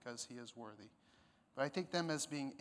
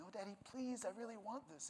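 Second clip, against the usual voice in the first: put-on higher, softer childlike begging; pleading little daughter; 7 semitones higher, 6 dB quieter than usual. Pastor imitating his daughter begging, higher and softer. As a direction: beg sweetly like a small girl asking Daddy. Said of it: "when my daughter really wants something".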